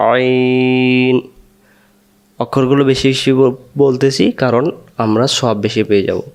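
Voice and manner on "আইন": আইন drawn out slowly, pressed from mid-throat; a long, strained আইন; throat-pressed ain. Shown as a demonstration. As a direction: squeeze আইন from the middle of the throat, long and slow. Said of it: "এটার নাম".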